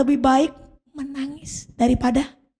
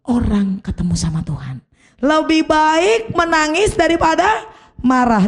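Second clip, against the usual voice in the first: ordinary talk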